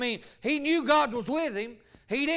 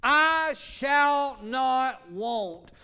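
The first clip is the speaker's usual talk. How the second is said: "I shall not want" spoken very slowly, word by word; deliberate, drawn-out scripture line; slow emphasis; much slower than usual. Shown as a demonstration. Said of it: "Now watch this".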